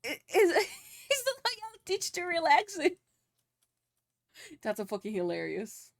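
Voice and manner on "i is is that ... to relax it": laughing disbelief. Real, not acted. Laughing at chat's story.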